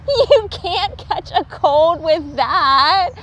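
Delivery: high, slow teasing squirrel voice, laughing through. A narrator's reading. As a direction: tease him while still laughing. Squirrel figure voice.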